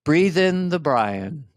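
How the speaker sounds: very slow, calm, soothing presidential voice; President Brian. Acted. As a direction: lull them with drawn-out, low-key calm. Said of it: "This is my impression of our next president".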